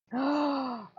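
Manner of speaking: quick delighted intake of breath; sharp gasp. Real, not acted. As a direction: gasp as the idea hits. Struck by a sudden idea.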